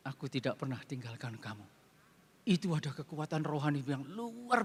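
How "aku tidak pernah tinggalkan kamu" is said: soft, low answer from God; soft reassuring voice of God; hushed divine voice. Retold or imitated. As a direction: speak it gently, almost under the breath.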